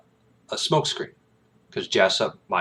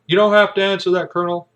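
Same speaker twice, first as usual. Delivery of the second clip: quick, raised stern warning; judge warning; 10 semitones higher, 7 dB louder, faster than usual. Retold or imitated. Judge warns Jessup he need not answer, brisk and raised. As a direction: cut in fast to stop the witness. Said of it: "the judge is like".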